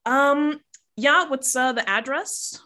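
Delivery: high, bored dispatcher voice; operator voice. Acted. Tow dispatcher answering the call, high and bored. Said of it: "then she goes".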